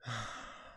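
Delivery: soft wordless sigh. Acted. Lucilla reacts to the waiter's plea, sighing softly. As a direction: let out a soft, weary breath. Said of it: "you hear a sigh".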